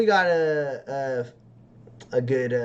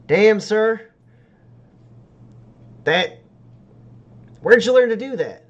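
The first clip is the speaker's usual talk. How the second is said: higher, louder, amazed mechanic; 7 semitones higher, 9 dB louder than usual. Acted. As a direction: marvel out loud at the stranger's work. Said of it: "he goes".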